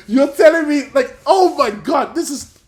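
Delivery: a fan losing it with joy; excited laughing shout. Retold or imitated. Gushing about the Kuro actor.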